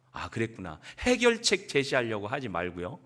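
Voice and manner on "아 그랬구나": lower, softer understanding sigh; soft empathy. Shown as a demonstration. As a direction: show how gently it's done. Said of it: "해봐, 해봐 좀". (남편들에게 보여주는 부드러운 공감 시범)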